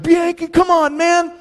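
high teasing mock shove; high mocking guests urging him up; teasing wedding guests. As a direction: needle him like pushy guests.

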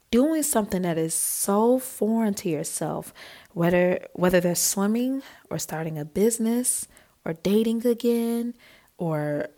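plain reading voice

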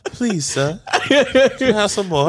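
slow, mock-pitiful plea through laughter; hammed-up humble begging; begging orphan. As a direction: plead like a hungry storybook waif. Mock orphan begging, quoting Oliver.

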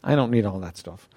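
low, self-talk of a contented believer; refusing stuff. Retold or imitated. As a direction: say no to yourself, firmly but low. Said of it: "you gotta say".

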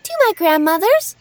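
put-on high, sweet little girl; bright young girl promising. Girl answers the wolf.

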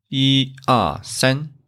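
slow 一二三 with 一 high and level; 一 in first tone. Shown as a demonstration. Teacher counts in sequence for students, 一 level in slow 一二三. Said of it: "it stay first tone".

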